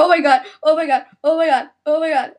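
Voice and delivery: loud repeated oh my god; panicked exclamation. Real, not acted. Reacting to the virus death, panicked. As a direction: keep repeating it, rising panic.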